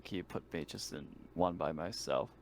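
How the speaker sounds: ordinary talk, nothing marked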